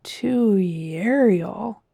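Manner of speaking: "Dewey Ariel" drawn out slowly as a question; slow, questioning repeat of "Dewey Ariel"; mouthing the word. A narrator's reading. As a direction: sound out "Dewey Ariel", puzzled, rising at the end. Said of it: "The captain mouthed the word".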